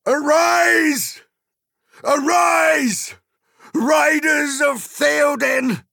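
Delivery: high, full-force rallying shout with long drawn-out words; loud battle cry. A narrator's reading. King rousing his riders to war, loud battle cry. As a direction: roar the riders into the charge. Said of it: "he cried in a loud voice".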